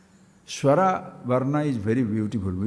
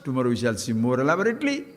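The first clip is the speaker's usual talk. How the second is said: ordinary talk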